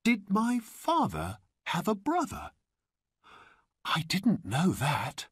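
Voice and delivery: put-on very high, wondering boy's voice; surprised. A narrator's reading. Boy questions new uncle, astonished. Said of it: "He was very surprised".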